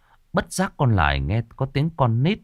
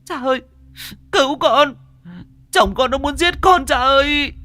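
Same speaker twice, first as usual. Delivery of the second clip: much higher, louder, frantic cry; desperate scream for help; 5 dB louder than usual. A narrator's reading. Lài screams to her father outside the door to save her. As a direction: shriek for help with all she has left. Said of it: "cố sức gào lên".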